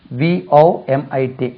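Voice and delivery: ordinary talk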